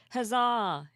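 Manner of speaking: loud, bright huzzah; excited cheer. Real, not acted. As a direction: shout it like a triumphant knight. Cheers the new word from chat.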